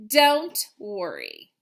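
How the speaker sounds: slow, tender don't worry; reassuring. Acted. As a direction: soothe the teacher kindly. Wimberly's reassuring last line.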